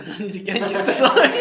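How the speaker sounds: loud laughing denial. Real, not acted. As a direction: laugh through the words. Laughing while denying.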